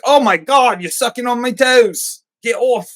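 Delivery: high, loud, squealing mock outrage; mock prudish panic. Daniel mocked as a prude pushing Mia off his toes, loud and high.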